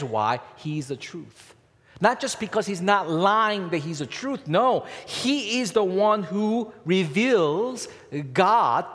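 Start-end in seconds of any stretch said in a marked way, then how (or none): 2.0-8.9 louder and higher, slowing on the key words; emphatic preaching. Acted. Pastor pressing the truth on the congregation, loud emphatic preaching. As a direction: drive the point home from the pulpit.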